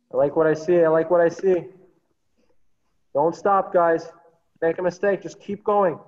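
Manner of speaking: loud encouragement. Real, not acted. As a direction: cheer them on from a distance. Coach shouting encouragement across the field.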